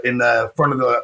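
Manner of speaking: usual speaking voice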